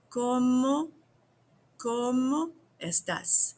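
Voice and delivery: cómo estás said slowly, syllable by syllable; slow, careful model phrase; slow teaching demo. Shown as a demonstration. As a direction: spell it out for learners. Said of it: "everybody say it again".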